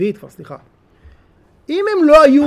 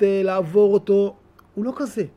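ordinary talk, nothing marked

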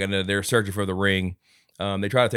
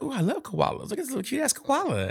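much higher, soft gushing; cooing woman; 4 dB quieter than usual. Retold or imitated. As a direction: gush over the koala. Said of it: "she's like".

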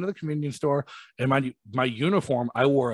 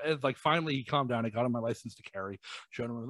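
normal talking voice

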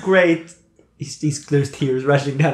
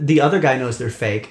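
ordinary talk, nothing marked

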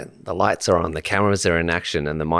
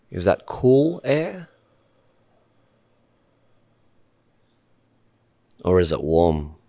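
calm meditation leader, long pauses between short phrases; unhurried guidance, letting each phrase settle in silence; guided meditation. Real, not acted. Host guiding podcast guests to sit and breathe, calm meditation voice.

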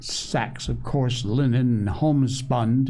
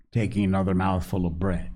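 usual speaking voice

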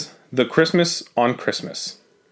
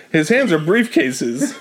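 stifled giggles; 11 semitones higher, slower than usual. Real, not acted. Reading while laughing.

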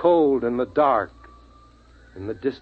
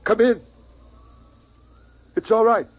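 calling out; 11 semitones higher, 3 dB louder than usual. Acted. Father calls to strangers outside.

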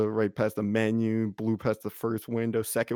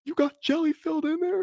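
much higher, mock bro question; squeaky bro. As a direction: squeak the joke out. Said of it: "He's like".

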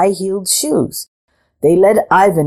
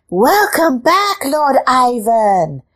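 high, slow cat voice; crying cats; 7 semitones higher, slower than usual. A narrator's reading. Cats greeting Lord Ivan, high slow cat voice. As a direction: call it out like a chorus of cats. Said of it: "the cats cried".

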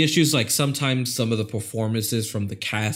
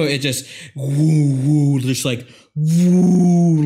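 whooshing woo; 5 semitones higher, 6 dB louder, much slower than usual. Retold or imitated. Imitating a gun flying far.

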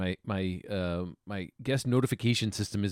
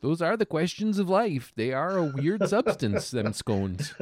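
folksy, lilting character, higher than usual; musing Harold; 6 semitones higher than usual. Acted. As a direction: muse like a puzzled folksy neighbor. Plays Harold musing on scones.

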